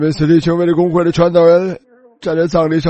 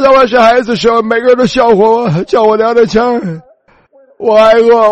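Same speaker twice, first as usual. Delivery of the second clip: 提高音量，声嘶力竭地向神哀求; 像在床边绝望地向神哭求; 哀求呼喊; 4 semitones higher, 4 dB louder than usual. Retold or imitated. Retold desperate prayer, pleading. (向神哭求带走自己，大声哀求)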